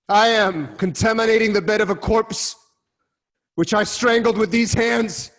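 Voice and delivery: loud, high, despairing self-condemnation; anguished outcry. Acted. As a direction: hurl each accusation at yourself. Oedipus raging at his own fate.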